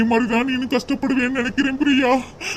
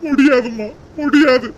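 louder, defiant no; firm loud refusal; 5 dB louder than usual. Acted. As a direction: refuse her outright. Son refuses mother firmly.

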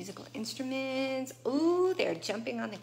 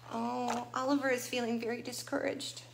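ordinary delivery, nothing marked

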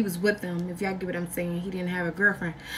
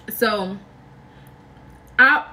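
usual speaking voice

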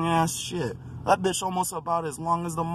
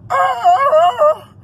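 high, loud fake crying wail; mock wail; 11 dB louder than usual. Mimicking the upset driver with a loud wail. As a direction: let out a whiny cry. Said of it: "this motherfucker was like".

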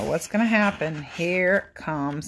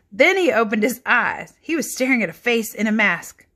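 put-on lively read-aloud for children; animated picture book narration; storybook reading; 4 semitones higher, 5 dB louder than usual. A narrator's reading. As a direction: read it like bedtime story time.

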